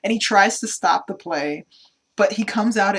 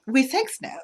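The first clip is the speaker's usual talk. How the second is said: higher-pitched mock Russian accent; fake Russian accent; 6 semitones higher than usual. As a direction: imitate her weird Russian English. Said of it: "that weird fake Russian accent".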